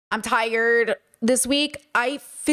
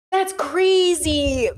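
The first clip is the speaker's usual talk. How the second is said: "That's" stretched very slowly in disbelief; drawn-out disbelief; 3 semitones higher, much slower than usual. Real, not acted. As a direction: drag "That's crazy" out slowly.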